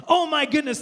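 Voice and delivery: high, loud scandalized exclamation; hammed-up moral shock; mock horror. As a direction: exclaim as if appalled. Acts outraged judging public.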